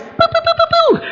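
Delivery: very high popping fireworks sounds; playful sky-burst noises; firework booms. Retold or imitated. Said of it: "And there's fireworks".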